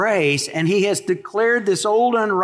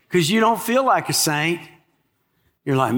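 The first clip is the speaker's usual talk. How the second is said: ordinary talk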